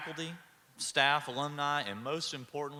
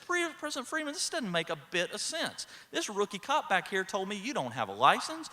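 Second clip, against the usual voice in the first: imitating baffled disbelief, raised pitch; higher voice, listing each charge incredulously; completely puzzled; 6 semitones higher than usual. Senior cop confronting the president with rookie's claims, baffled. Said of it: "senior cop is completely puzzled".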